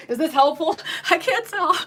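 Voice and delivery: can barely get the question out for laughing. Real, not acted. Host cracking up while checking if the review helps, laughing. Giggling fit during wig review.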